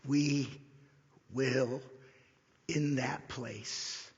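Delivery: word by word, long pauses, sinking low; solemn conclusion. Real, not acted. Preacher telling believers what makes heaven heaven, slow and hushed. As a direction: space out each phrase, softening to the end. Final point of the sermon.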